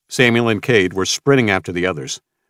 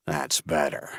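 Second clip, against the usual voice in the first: cold quiet satisfaction; 5 semitones lower, 8 dB quieter than usual. Acted. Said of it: "a version of Mason".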